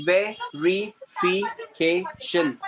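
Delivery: "Verification" very slowly in separate syllables. Shown as a demonstration. Teacher repeats student's word as a model, syllable by syllable. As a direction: chop "Verification" into evenly spaced beats. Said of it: "syllable में cut करके बोलना".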